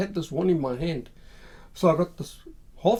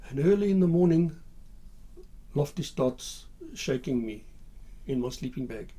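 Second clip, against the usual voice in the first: usual speaking voice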